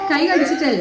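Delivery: a mimicked whiny sob; mother teasing the crying child with a fake wail; mock crying. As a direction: echo her crying back at her. Mother mocks daughter's crying.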